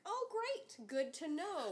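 high, startled "oh great"; sarcastic surprise. Retold or imitated. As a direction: squeak it out, caught off guard. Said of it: "I was like".

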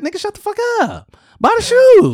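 imitated outburst at noisy shoppers; mock yell at customers. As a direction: screech at the arguing couple. Yelling at arguing foreigners.

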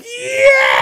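high, loud cheering yell; crowd cheer. Retold or imitated. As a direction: cheer like a wrestling crowd going nuts.

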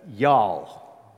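a touch of Southern American drawl; Southern US accent. Shown as a demonstration. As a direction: lightly put on a Southern US accent. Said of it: "that sort of southern U S".

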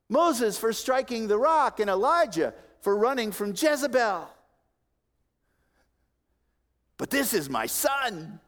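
high, loud, forceful preacher voicing God; God rebuking and commending. Preacher as God comparing his Son to prophets, loud and high. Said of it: "With him I am well pleased".

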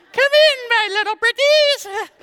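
high, loud cackling witch, slow. Retold or imitated. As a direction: lure the children into the gingerbread house. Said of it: "sounded like the witch".

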